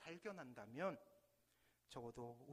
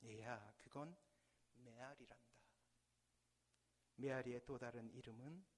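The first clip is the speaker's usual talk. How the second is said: fatherly, gentle; 5 semitones lower than usual. Acted. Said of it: "아버지가 껄껄 웃으시면서".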